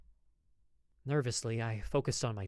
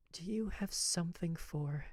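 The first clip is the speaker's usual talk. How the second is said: nervous whisper; 5 semitones higher, 3 dB quieter than usual. Acted. Lady confiding her heartache to the apothecary, whispered. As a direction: let it fall into a whisper. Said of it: "I saw her nervous".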